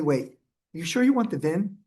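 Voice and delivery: ordinary talk, nothing marked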